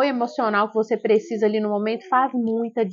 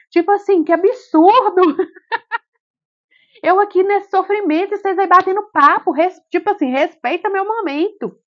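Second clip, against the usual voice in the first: high, loud, outraged complaint; mother scolding the staff for chatting, high and loud; indignant outburst; 8 semitones higher, 6 dB louder than usual. Retold or imitated. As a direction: tell them off, fed up. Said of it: "eu falava".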